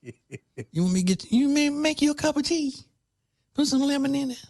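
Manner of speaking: high cooing, fussing over someone; doting comfort voice. Retold or imitated. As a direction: coo over a sick friend. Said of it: "you wanna go".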